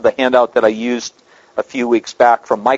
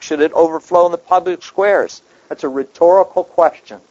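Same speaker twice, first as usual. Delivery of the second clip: ordinary talk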